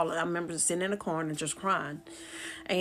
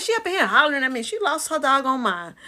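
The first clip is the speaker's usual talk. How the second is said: raised, high, fuming retort; indignant complaint; 7 semitones higher, 7 dB louder than usual. Retold or imitated. Explaining the argument to relatives, indignant. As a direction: rush it out loud and outraged.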